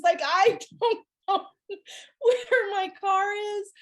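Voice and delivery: high, slow, laughing through the words. Real, not acted. Teller laughs telling friends she still cannot find her car. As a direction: crack up at your own mess.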